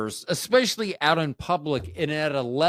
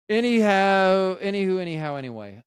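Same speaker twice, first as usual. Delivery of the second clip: catchphrase; 6 semitones higher, 5 dB louder than usual. Acted. Running catchphrase.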